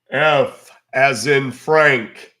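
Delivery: loud and very slow, spelling out. Real, not acted. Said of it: "Can you repeat again".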